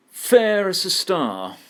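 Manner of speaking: "Fair" hit hard, high and loud, then slowly "as a star"; stress on the first syllable. Shown as a demonstration. Teacher showing students the stressed first syllable, punchy and slow. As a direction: lean on "Fair" and slow the phrase down. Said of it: "breaks it because the first syllable there is stressed".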